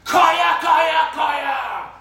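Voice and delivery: very loud, high, drawn-out cow call; shouting cow call. Retold or imitated. Teenagers calling the cows from across the valley, full shout. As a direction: yell it across the field at the top of your lungs. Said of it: "shouting at the top of our voices".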